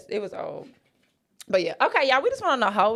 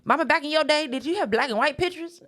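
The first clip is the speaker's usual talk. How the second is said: put-on kid's voice; 8 semitones higher than usual. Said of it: "you just be like".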